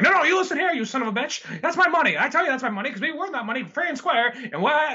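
high-pitched, worked-up arguing voice; angry protest. Acted. Acted quarrel over the fight money.

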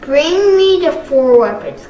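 loud commanding order; demanding. Acted. Garmadon demanding the weapons, loud. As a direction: order it forcefully. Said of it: "Garmadon demanded".

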